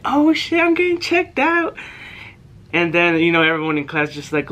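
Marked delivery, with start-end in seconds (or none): 0.0-1.8 high, startled mock panic; panicked oh shit. Kid hearing his name called over the intercom, high startled panic. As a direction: squeak it out in sudden alarm.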